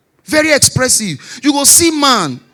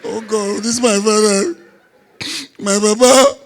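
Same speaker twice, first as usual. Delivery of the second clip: hammed-up tearful wail for a father; mock crying. Man fakes grief to dodge his wife's demands, mock crying. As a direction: fake sobbing through the words. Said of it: "Now I start cry".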